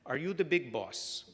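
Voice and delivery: put-on a light thick Italian accent for the customer. Newsstand owner asks the new pharmacist, light Italian accent. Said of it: "asked him in a thick Italian accent".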